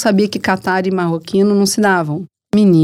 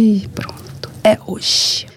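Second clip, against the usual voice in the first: hushed, nearly voiceless aside; retold 'uh-oh' under the breath; mock dread aside; 3 dB quieter, much slower than usual. Reporter to herself as the director calls, hushed dread.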